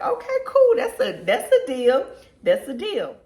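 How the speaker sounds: high, loud, laughing acceptance; delighted laughing yes. Retold or imitated. Wife agreeing to husband's offer, laughing with delight. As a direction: squeal it out, thrilled at the deal.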